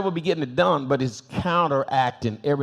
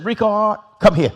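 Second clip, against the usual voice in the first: louder sharp call of a name; upset teacher call; 6 semitones higher, 6 dB louder than usual. Retold or imitated. Teacher fed up, calls the pupil forward louder. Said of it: "she got upset".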